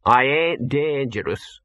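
slow, drawn-out wail of protest; mock-pitiful doggy wail; wailing talking dog. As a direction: protest like a put-upon dog. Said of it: "wailed Gaspode".

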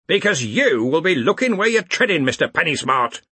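loud exasperated boss; roared. A narrator's reading. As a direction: bellow the retort at a subordinate, dropping on the name. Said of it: "roared Ridcully".

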